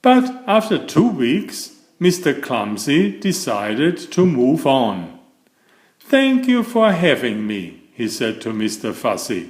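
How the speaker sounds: lively read-aloud for children, voicing each character; storybook narration. A narrator's reading. Reading a Mr Men book to young viewers, animated storytelling. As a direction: tell it like a bedtime picture book.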